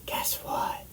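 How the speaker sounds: secretive whisper; fully hushed, breath only. Retold or imitated. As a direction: whisper it like a secret about to spill. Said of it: "and I whisper".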